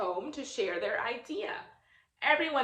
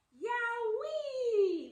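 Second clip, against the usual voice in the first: high, thrilled burst of delight; excited yelp; 12 semitones higher than usual. Acted. Mira cheering as her color hits the wall, excited yelp. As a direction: squeal it like the wall just lit up.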